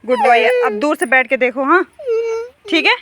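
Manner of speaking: high, loud, coaxing praise; mother praising her hurt son loudly to calm him; loud soothing. Real, not acted. Comforting crying son.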